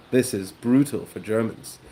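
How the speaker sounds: ordinary talk, nothing marked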